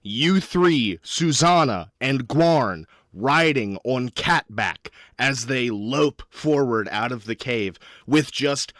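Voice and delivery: slow, stretched-out epic narration; dramatic scene setting. Acted. Game master sets up the escape on catback, dramatically. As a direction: announce the big action shot with relish.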